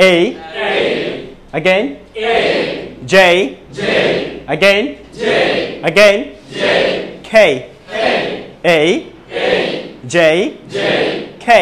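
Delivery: "A", "J", "K" said slowly one by one with long gaps, as English letter names; careful English letter names. Shown as a demonstration. Teacher leading students through the letters A, J, K, slow and careful. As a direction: spell out A, J, K slowly for the class to repeat. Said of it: "সবাই একটু একসাথে বলি তো".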